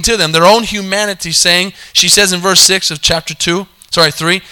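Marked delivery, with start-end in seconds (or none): none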